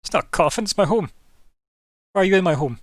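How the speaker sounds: loud, high offended protest; indignant shout. Acted. Vampire shouting at the party that the coffin is his home, indignant. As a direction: bristle and shout back.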